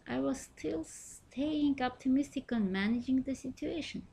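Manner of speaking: ordinary delivery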